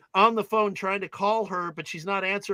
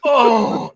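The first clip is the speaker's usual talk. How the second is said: loud, higher crowd "oh"; imitated audience gasp; 6 semitones higher, 10 dB louder than usual. Retelling how the whole audience reacts, loud imitated gasp. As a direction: mimic a whole theater reacting aloud. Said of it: "The audience just goes".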